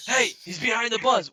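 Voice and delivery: loud, high urgent warning; mock game callout. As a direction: holler the enemy's spot.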